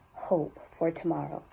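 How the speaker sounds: low landing. Acted. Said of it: "she went low with that".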